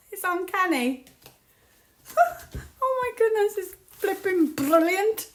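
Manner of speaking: high, excited amazement through laughs; delighted laughter. Real, not acted. Tarot reader finding the cards uncanny, laughing and thrilled. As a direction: laugh in real disbelief and delight.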